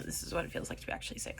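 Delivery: fast, unvoiced whisper; hushed realisation. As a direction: whisper it as a grim discovery. Realising she is truly sick.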